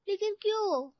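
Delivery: put-on high-pitched child's protest; young girl questioning her mother; protesting daughter. A narrator's reading. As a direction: whine a puzzled why, high.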